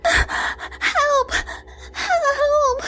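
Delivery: put-on girl shrieking at the ghost. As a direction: scream for help in terror. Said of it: "Jessie shrieked".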